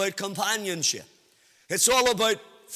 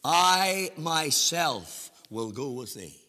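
slow, weighty reading; 7 semitones lower, 4 dB quieter, slower than usual. Shown as a demonstration. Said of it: "It could be translated like this".

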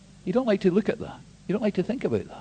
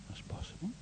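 breathless whispered repeat; 20 dB quieter, faster than usual. Shown as a demonstration. Preacher letting the thought of death sink in, whispered. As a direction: drop it to a whisper.

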